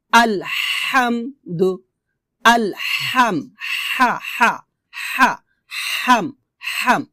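আলহামদু, হা, হাম with ح squeezed from mid-throat; আলহাম slowly, tight pharyngeal h; pressed thin ha. Shown as a demonstration. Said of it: "ঠিক এভাবে আপনাকে উচ্চারণ করতে হবে".

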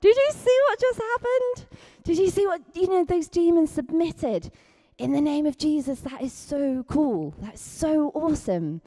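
high, thrilled whoops and gushing; excited disciples. Retold or imitated. Disciples cheering to each other after casting out demons, high and thrilled. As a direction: play a giddy disciple back from a win, voice soaring. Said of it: "and being like".

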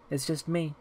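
put-on soft, small child's voice; little girl. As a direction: speak softly like a little girl. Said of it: "Sounded like a little girl".

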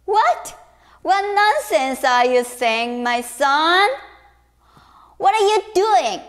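father rebuking son angrily; angry father. Acted. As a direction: scold the son furiously. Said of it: "his father was so angry".

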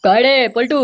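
high-pitched holler to someone indoors; calling out. Acted. Father calling his son to the visitors, hollering. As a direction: raise the voice and summon him.

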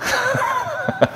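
a soft, high burst of laughter; quiet high chuckle; self-amused laugh. Real, not acted. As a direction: laugh at your own acting. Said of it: "연기를 너무 잘하나?".

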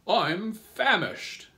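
loud, high, drawn-out grasshopper voice; old grasshopper character. A narrator's reading. Old green grasshopper joins in on hunger, high drawn-out declaration. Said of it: "the old green grasshopper said".

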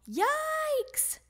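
long, very high yelp; high-pitched drawn-out alarm; alarmed scream. A narrator's reading. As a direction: stretch it out high while flying off. Froggy flying with the ball.

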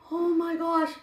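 usual speaking voice

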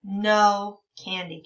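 put-on slow and clear, each word laid out for kids; slow modeled reading. Shown as a demonstration. Teacher modeling a sentence slowly for young readers. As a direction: read it slowly so children can follow. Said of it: "Can you read it with me?".

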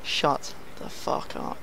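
slow breathy hushed taunt; Aguero taunt. Retold or imitated. Striker silencing the crowd, slow hushed taunt. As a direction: hiss it slowly at the crowd. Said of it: "Aguero says".

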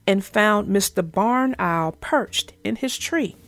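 gentle read-aloud for children, voicing each animal; story narration. A narrator's reading. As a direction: tell it like a bedtime storybook.

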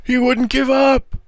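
very high-pitched wail; squealed, whining howl; howled protest. A narrator's reading. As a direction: howl it in self-defense. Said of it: "howled Green".

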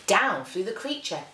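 ordinary delivery

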